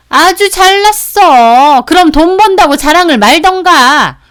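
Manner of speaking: loud, high, scornful sister-in-law; 비꼬는 시누이 고함. Acted. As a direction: sneer at full volume. Sister-in-law sneering in quarrel. (크게 소리치며 비아냥거리는 말투)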